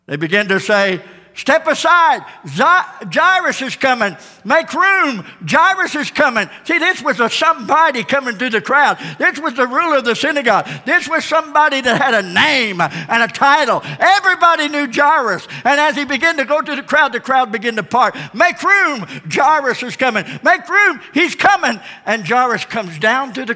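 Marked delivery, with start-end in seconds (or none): 1.3-6.5 crowd calling out. Acted. Crowd clearing a path. 18.3-21.8 shouting to make way. Acted. Crowd parting for Jairus.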